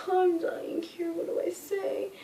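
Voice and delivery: high-pitched, hammed-up whimper; mock crying. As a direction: act out being close to tears. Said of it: "And I started crying".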